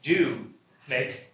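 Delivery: one word per speaker, halting; halting word-by-word delivery; one word at a time. Pretend. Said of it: "They can only speak one word at a time".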